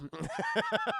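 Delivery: a sudden high squeal of laughter. Real, not acted. Streamer cracking up at his own joke, high squealing laugh. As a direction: break into a shrieking laugh.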